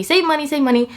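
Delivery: ordinary delivery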